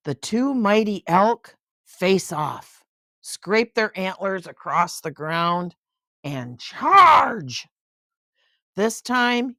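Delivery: storybook narration, lively and clear; bedtime-book reading voice; reading to a child. A narrator's reading. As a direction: read it aloud to a little one.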